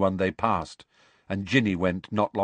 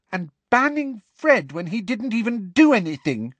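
high and louder, outraged; furious; 14 semitones higher, 5 dB louder than usual. A narrator's reading. Alicia to the common room about Fred's ban, furious and high. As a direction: spit out the injustice in a raised voice. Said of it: "said Alicia furiously".

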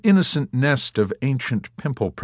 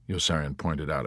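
usual speaking voice

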